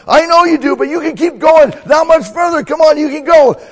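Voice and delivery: top-volume, very high coach yell; hoarse marathon cheering. Acted. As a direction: scream them to the finish. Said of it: "closer to the end".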